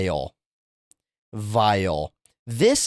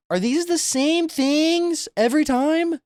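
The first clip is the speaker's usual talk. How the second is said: incredulous; slower than usual. Real, not acted. Streamer reacts to gross menu.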